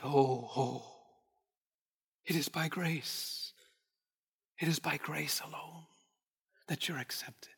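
a hushed, tender whisper. Retold or imitated. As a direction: whisper it gently to a dying man. Tender retold reassurance to dying man.

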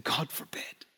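breathy hushed whisper; retold whisper of horror. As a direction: breathe it out in dread. Knox's hushed reply retold.

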